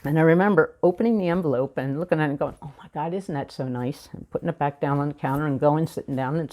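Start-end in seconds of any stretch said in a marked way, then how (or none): 2.6-4.1 soft, offhand reaction to oneself; retold casual remark. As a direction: toss it off lightly, well under your usual volume. Casual reaction on opening check.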